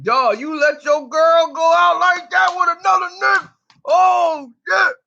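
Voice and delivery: high, loud, strained yelling; a loud, high-pitched crying-out voice; outraged yell. Retold or imitated. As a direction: holler it like a friend who can't believe it. Homeboy outraged at friend's girl.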